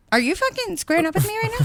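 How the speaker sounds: high, fast outraged challenge; high-pitched fast mock confrontation; incredulous challenge. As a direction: fire it off in disbelief. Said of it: "And I'm like".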